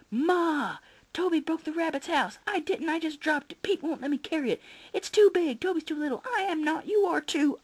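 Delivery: put-on kids yelling.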